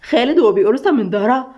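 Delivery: plain reading voice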